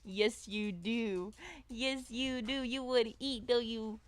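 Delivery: slow, sing-song doggy talk. Real, not acted. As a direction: tease the hungry dog gently. Baby talk to the dog.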